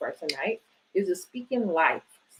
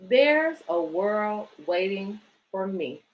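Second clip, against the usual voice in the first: put-on reading to children. Reads picture book aloud.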